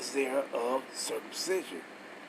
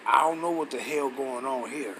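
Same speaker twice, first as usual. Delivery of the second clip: usual speaking voice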